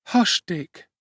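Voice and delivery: put-on high, urgent boy's voice; young Oliver. A narrator's reading. Oliver hushing his friend at the gate, high urgent boy. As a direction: hush him like a frightened boy.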